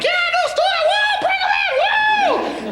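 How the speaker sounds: very high, squealing classroom cheer; mock children's shrieking; rowdy kids cheering. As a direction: whoop it up as a mob of excited kids. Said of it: "And they're all like".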